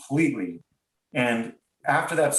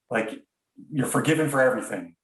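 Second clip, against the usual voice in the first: usual speaking voice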